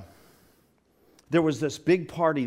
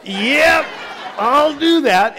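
high, loud, hammed-up yeses; eager agreement; 12 semitones higher, 11 dB louder, much slower than usual. Son jumps at fifty dollars to call mom, hammed-up eager shout. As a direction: shout an over-eager promise. Acting out son's eager answer.

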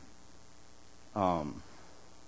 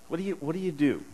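normal talking voice

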